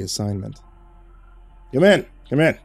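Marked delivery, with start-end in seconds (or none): 1.7-2.6 a higher, louder, jovial boss voice; a hint of a smug boss welcoming him in; grinning boss. A smug boss greets his reporter, jovial.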